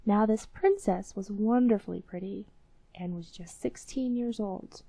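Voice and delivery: ordinary delivery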